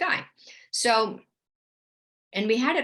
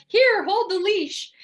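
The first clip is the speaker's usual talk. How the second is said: high, loud, stretched-out order; excited command; 12 semitones higher, 7 dB louder, slower than usual. Retold or imitated. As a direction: reenact an urgent, excited handoff. Retelling her excited rush to report.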